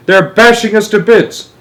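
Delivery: high, loud alarm; shouting. A narrator's reading. Astronaut under attack to the president, shouting. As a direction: shout the damage report. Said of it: "Shuckworth was shouting".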